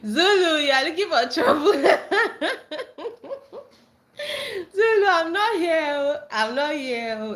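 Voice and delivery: high, loud, laughing through words; half-crying with laughter; giggling protest. Real, not acted. Laughing at a teasing commenter.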